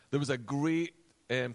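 ordinary delivery

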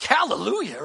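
loud, swooping mock celebration; playful church-style exclamation; jubilant hallelujah. Mock celebration of a small mistake.

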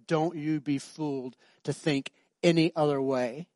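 ordinary talk

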